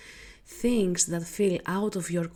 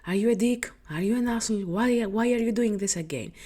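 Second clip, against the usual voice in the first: scolding, higher and louder questions; judging inner voice; 3 semitones higher, 3 dB louder, faster than usual. Acted. Inner voice judges an overgiver, sharp judgmental scolding. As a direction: berate them with exasperated questions. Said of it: "judges you with the voice".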